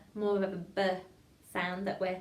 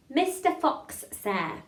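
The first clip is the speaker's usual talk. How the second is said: higher, louder, carefully separated words; tongue-twister demo; 4 semitones higher, 5 dB louder, much slower than usual. Shown as a demonstration. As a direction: model the line for a class to repeat. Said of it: "I'm gonna teach it to you".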